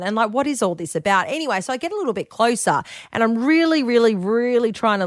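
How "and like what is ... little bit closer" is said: ordinary talk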